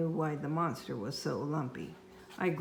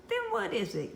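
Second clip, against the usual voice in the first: yelled; 6 semitones higher than usual. Acted. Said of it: "yelled Mom".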